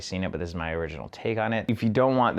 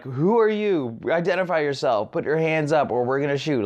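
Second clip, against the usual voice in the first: raised, higher-pitched mock police command; police challenge; 6 semitones higher than usual. Police confront an unknown Spider-Man, raised commanding voice. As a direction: order a suspect about, voice pitched up.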